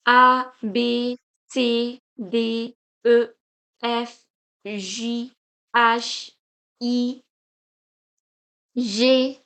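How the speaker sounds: French letter names from A as "ah" to J as "zhee"; French alphabet drill. Shown as a demonstration. Teacher leading repeat with me, French letter names. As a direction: recite A to J in French for repetition. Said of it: "Now you can repeat it with me".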